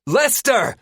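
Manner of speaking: high, loud calls for help; yell. A narrator's reading. Hurt woman calling the others from below, yelling. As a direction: yell names across the boat. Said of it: "Terry yells".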